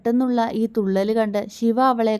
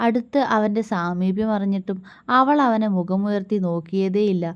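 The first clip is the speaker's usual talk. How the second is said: usual speaking voice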